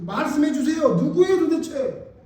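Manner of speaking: high, loud, drawn-out demand; acted insistent plea. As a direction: act out pressing hard for an answer. Voicing Peter pressing for answer.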